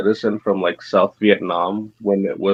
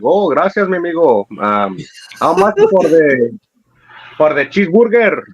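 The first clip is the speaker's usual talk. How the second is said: put-on Mexican accent; 7 semitones higher than usual. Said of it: "play accent war with people".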